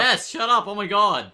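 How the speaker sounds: high, loud laughing shout; yelling. Real, not acted. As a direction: shout over the noise. Yelling at phone.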